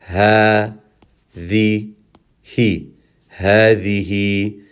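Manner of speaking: ha, the, he as separate drawn syllables; long ha, short the and he; one syllable at a time. Shown as a demonstration. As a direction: pause between ha, the, he. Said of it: "taking one vowel at a time".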